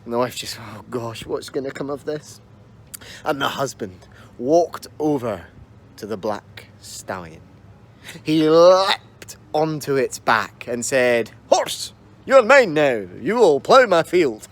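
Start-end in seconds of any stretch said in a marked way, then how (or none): 0.6-2.4 quiet, fretful worry; worried wife. Acted. Wife fretting in story. 11.5-14.5 slow, loud, lordly command; commanding husband. Acted. Husband mounting horse.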